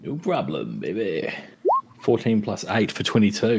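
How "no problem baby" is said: cocky. Acted. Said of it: "cocky reply to roll".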